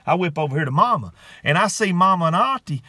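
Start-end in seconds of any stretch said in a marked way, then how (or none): none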